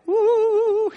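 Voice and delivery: high eerie woo sound; a ghostly rising woo for the energy creature; spooky woo. Acted. Preacher giving the energy being a high, spooky woo. Satan feeding off conflict.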